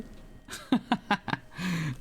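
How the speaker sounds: rapid chuckling laugh; amused laugh. Real, not acted. Streamer chatting with a viewer about accents, amused laugh. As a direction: laugh it off in short bursts.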